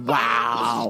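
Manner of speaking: low, drawn-out WOW; low mock disbelief; appalled wow. Appalled reaction to the gremlin insult.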